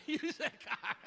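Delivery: high, giggly delivery breaking into laughter; laughing. Retold or imitated. Patient amused by doctor's joke mid-exam, laughing. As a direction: squeal it through helpless mock giggles. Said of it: "you're laughing".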